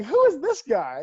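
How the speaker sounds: loud, high, drawn-out mock bewilderment; baffled disbelief. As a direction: ask it slowly, hammed-up and affronted. Player's baffled reaction, retold.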